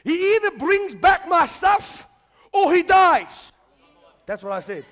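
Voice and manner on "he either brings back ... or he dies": slow, loud shout at heaven; retold shout to God. Preacher retelling his prayer on the pavement, loud slow shout. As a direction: cry out to God with hands raised, much higher. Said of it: "I shouted".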